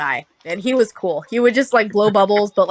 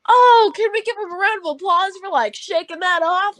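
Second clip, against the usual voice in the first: high-pitched hype-man announcer; 10 semitones higher than usual. Retold or imitated. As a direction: work the crowd for applause, hype style. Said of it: "still in that hype man voice".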